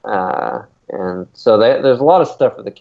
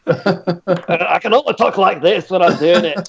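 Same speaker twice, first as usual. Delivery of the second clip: laughing, higher mock British Isles accent, slowly; put-on British accent; 8 semitones higher, slower than usual. Explaining to cohosts he needs the accent, laughing, hammed-up British accent. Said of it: "Helps if you do the accent".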